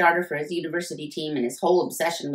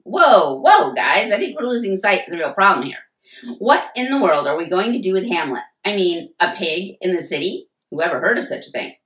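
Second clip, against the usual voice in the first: much louder, fast showman's alarm; loud, hammed-up brotherly outrage; dramatic deflection; 3 semitones higher, 8 dB louder than usual. A narrator's reading. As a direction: hype it up to change the subject.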